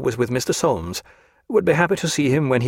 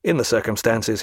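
ordinary delivery

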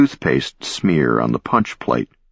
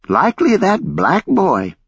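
put-on old lady voice. A narrator's reading. Same old lady keeps talking.

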